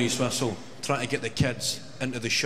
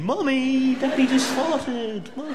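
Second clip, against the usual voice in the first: put-on high, louder child voice, slowing on the tattle; a kid telling on dad to mum; whiny kid; 14 semitones higher, 4 dB louder, slower than usual. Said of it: "It's that accent again".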